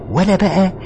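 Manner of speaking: loud, high heated argument; heated shout. Acted. Groom arguing with bride about their wedding night, loud.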